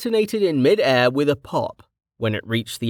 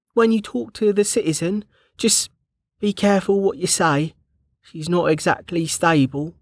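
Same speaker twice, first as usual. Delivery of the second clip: higher, careful worried guard; Bregger's voice; 8 semitones higher than usual. A narrator's reading. Bregger warning Kettle about the citizen, anxious. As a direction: voice the nervous rule-follower.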